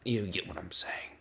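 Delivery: breathy whisper under the music; whispered aside. Real, not acted. Whispering to viewers that he's rambling. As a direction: mutter it to yourself, half to the mic.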